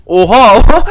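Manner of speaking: loud shocked "oha"; startled exclaim. Real, not acted. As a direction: blurt it out in disbelief. Lucky hit for opponent. (şaşkınlıkla yüksek ünlem)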